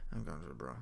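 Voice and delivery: low, soft mock Daryl line; Daryl impression. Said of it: "that'd be how Daryl said it".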